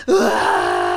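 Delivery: drawn-out horror-film yell; scream of fright. Retold or imitated. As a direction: scream in acted terror. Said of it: "he's just freaking out".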